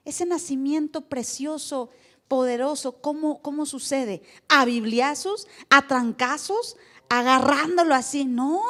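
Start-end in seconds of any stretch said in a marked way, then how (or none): 4.5-8.3 louder, slow, mocking each method; mocking loud question. Real, not acted. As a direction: hammer each question home with scorn. Rhetorical mock of forced conversion. (preguntas burlonas, fuertes y lentas)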